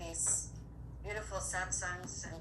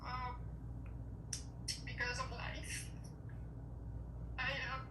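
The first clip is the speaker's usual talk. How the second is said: emotional; 6 semitones higher than usual. Real, not acted. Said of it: "Sorry, I'm a little emotional".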